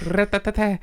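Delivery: high rattling tap-dance noise; imitated tap dancing. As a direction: rattle out tap steps by mouth. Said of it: "I'm a tap dancer".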